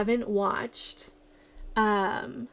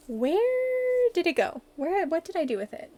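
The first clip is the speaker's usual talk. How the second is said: puzzled; 7 semitones higher than usual. Real, not acted. Searching for lost sketchbook.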